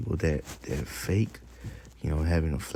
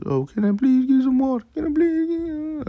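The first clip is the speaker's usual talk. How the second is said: very high falsetto begging, puppy-eyed; mock pleading; 4 dB louder than usual. Man begging a brother at camp for water, mock pleading in falsetto. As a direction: plead in an exaggerated squeaky whine. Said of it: "with puppy eyes and everything".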